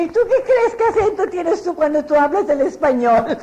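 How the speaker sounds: laughing comeback. Retold or imitated. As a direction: toss the question back with a laugh. Family's teasing comeback. (réplica burlona entre risas, más aguda)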